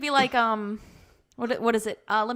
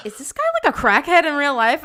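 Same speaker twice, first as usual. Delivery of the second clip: incredulous question; 4 semitones higher, 6 dB louder than usual. Retold or imitated. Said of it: "I'm like".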